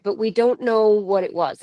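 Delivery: ordinary delivery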